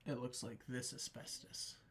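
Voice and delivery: quiet, breathy one-liner with pauses; mock CSI one-liner; Caruso one-liner impression. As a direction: whisper the pun like a TV cop. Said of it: "he goes, like".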